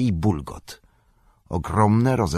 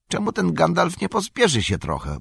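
much higher voice, an impatient hobbit grumbling; impatient Pippin; 12 semitones higher than usual. A narrator's reading. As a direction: grumble it as a fretful young hobbit. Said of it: "mruknął Pippin".

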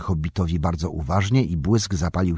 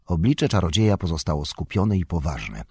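usual speaking voice

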